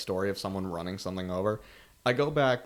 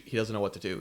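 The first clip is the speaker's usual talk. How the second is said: ordinary talk, nothing marked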